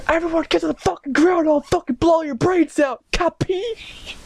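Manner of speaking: extremely high, squeaky robber voice; mock gunman threat. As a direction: squeal threats like a ridiculous stick-up man.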